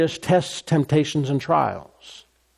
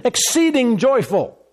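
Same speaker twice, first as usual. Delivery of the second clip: high, excited exclamation; exceedingly joyful; 9 semitones higher than usual. Shown as a demonstration. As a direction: burst out with joy, pitched well up. Demonstrates exceeding, unmistakable joy.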